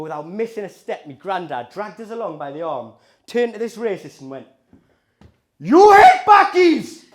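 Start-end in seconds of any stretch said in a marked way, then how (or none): 5.6-7.0 put-on slow, high shouted Indian accent; granddad's Indian accent. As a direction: bellow as an Indian granddad impression. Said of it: "my granddad has that accent".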